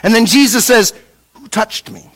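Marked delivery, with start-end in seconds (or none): 1.3-2.1 soft, nearly whispered question; hushed question. Retold or imitated. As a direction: ask it under your breath, acting it out. Jesus stops and asks the crowd.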